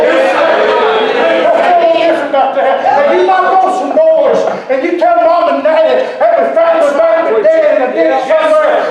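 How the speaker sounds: fiery preacher building to a shout; impassioned preaching. Real, not acted. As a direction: drive it home to the congregation at full force. Preaches at full force.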